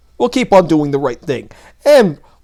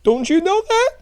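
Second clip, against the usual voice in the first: repeated way higher in mock disbelief; 12 semitones higher, 3 dB quieter than usual. Mocking a gun-control critic with a high, disbelieving repeat.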